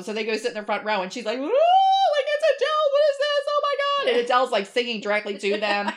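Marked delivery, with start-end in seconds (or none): 1.4-4.1 very high, loud fan squeal; starstruck squeal. Retold or imitated. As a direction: gush like a stunned fan in the front row. Girlfriend sees [name] up close.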